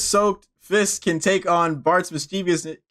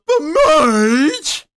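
high, loud cartoon husband whine; Homer Simpson; 11 semitones higher, 6 dB louder than usual. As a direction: protest loudly like a cartoon husband. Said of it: "And then he goes".

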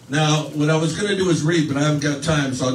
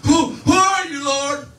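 much higher, loud panicked yell; terrified shout; 12 semitones higher, 5 dB louder, slower than usual. Retold or imitated. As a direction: scream the question in terror. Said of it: "probably twenty times louder than that".

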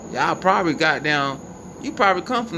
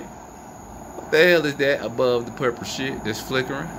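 ordinary talk

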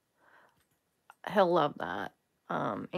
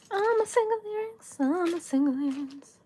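high, squeaky little earring voice; talking earring; 11 semitones higher, 3 dB louder than usual. Pretend. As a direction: pipe up playfully in a high put-on voice. Voices the single earrings, playfully.

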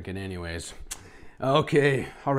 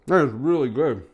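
ordinary talk

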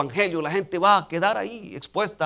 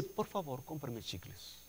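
put-on much softer, a small boy's timid plea; child begging softly; 19 dB quieter than usual. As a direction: ask meekly like a shy little boy. Small boy begging for gum. (súplica suave de niño pequeño)

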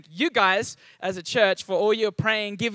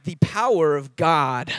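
slower "The power of God" with a clear rhotic "power", American-sounding; American-style accent; 3 semitones lower, 4 dB louder, slower than usual. Shown as a demonstration. Pastor showing how he says it in Taiwan, in American-style accent. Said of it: "In Taiwan, I have to be like".

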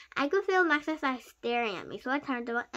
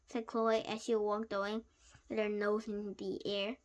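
ordinary delivery, nothing marked

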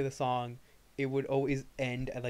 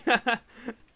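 loud laugh; 11 semitones higher, 11 dB louder than usual. Real, not acted. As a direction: burst of high, loud laughter. Laughing about mom calling.

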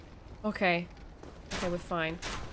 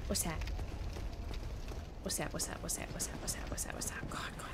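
rapid, hushed repeated question; quiet, anxious babble; alarmed muttering; 4 semitones lower, 11 dB quieter, faster than usual. Real, not acted. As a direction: rattle it off low and soft in alarm. Alarmed by something unknown in game.

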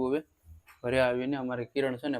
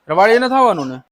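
calling out; 6 semitones higher, 11 dB louder than usual. Real, not acted. Calling across the yard.

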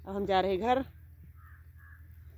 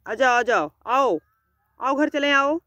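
loud, higher call across the field; 4 semitones higher, 5 dB louder than usual. Real, not acted. Owner calling a wandering pet home, loud coaxing call. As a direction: call the animal back home from afar.

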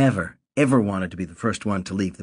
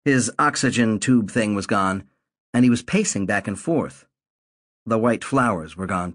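usual speaking voice

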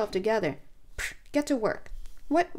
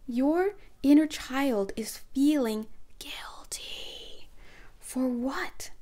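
whisper; 4 semitones higher, much slower than usual. Pretend. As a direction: lean in and whisper to the inner child. Whispered question to inner child.

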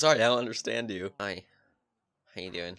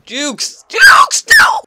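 excited yell; 19 dB louder, much slower than usual. Real, not acted. Dodging zombie in game.

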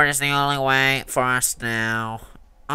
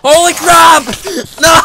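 startled shout; 14 semitones higher, 14 dB louder than usual. Real, not acted. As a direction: yell out in shock. Sudden shock in game.

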